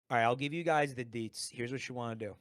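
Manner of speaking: ordinary delivery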